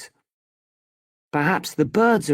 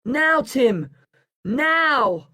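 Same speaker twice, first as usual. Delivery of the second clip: high, urgent signal to act; shouting; 8 semitones higher, much slower than usual. A narrator's reading. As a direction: call the cue out high. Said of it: "I shout".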